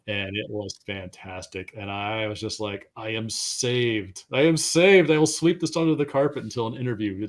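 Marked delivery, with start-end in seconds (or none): 3.0-4.2 slow, drawn-out relief; retold relief. Retells relief after the recording. 4.3-7.3 loud, high exclamation of relief; retold jubilant relief. Retells exclaimed relief, escalating.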